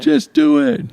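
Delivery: squeaky, high-pitched push to just do it; high cheering voice. Acted. Cheering friend on, second take.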